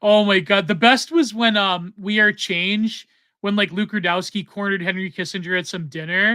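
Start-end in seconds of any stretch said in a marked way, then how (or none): none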